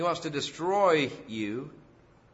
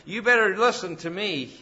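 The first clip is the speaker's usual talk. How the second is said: much louder and higher, booming self-praise; roaring lion boast; 7 semitones higher, 9 dB louder than usual. Acted. Preacher voicing a lion proclaiming power, loud booming boast. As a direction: roar it out like the king of beasts. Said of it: "they roar to say".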